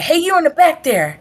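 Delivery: high-pitched instructor call-out; mock calling out to someone at the back; imitated yell. Instructor singles out a talking recruit, imitated yell. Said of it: "yell at you and cuss you".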